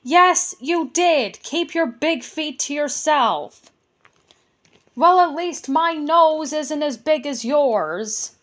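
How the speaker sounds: high, loud squabbling goblin; bickering goblin. Acted. Goblins argue about big feet and noses, squabbling. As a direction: trade insults like a petty goblin.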